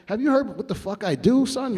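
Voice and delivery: high, cocky comeback; cocky retort. Retold or imitated. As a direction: put him in his place. Bragging retort to Sting.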